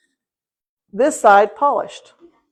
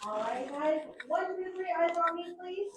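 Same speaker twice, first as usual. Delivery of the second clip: kindergarten teacher voice; 7 semitones higher, 17 dB quieter than usual. Real, not acted. Another adult calls kids to order.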